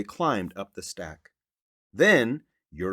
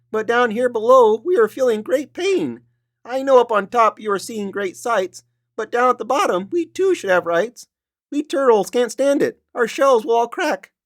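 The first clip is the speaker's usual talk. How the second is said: high, pained little turtle voice, near crying at the end; crushed turtle begging for food and rights; groaning Mac; 13 semitones higher, 7 dB louder than usual. Acted. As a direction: plead up to the king through the pain. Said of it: "groaned Mac".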